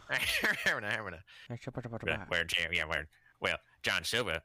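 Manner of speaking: lightly imitated wrestler, words garbled; Danhausen. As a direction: fumble an impression, restarting as it slips. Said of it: "Why do I keep doing the Danhausen voice?".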